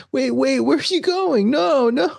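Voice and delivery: high mock pleading; pleading Hoffa. Hoffa begging Frank not to leave. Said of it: "he's like".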